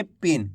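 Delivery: "पिन" with a clipped, short i; short i vowel. Shown as a demonstration. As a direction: keep the i in "पिन" brief. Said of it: "कम खींच के पढ़ेंगे". ("पिन" को कम खींचकर पढ़ें)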